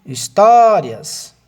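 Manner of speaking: Histórias drawn out slowly, the syllable tó held loud and high; Histórias stretched, tó leaned on hard; stressed syllable. Shown as a demonstration. Teacher shows the class, Histórias slow with tó stressed. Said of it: "a sílaba tônica é o to".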